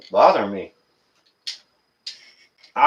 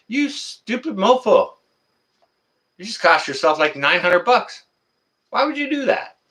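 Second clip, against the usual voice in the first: scolding quote; 10 semitones higher than usual. Retold or imitated. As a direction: chide the phone thrower in disbelief. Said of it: "I'm like".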